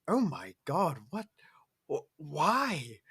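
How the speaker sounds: high startled gasp of disbelief; shocked mom. Retold or imitated. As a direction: sound stunned and confused. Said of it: "looking at this thing like".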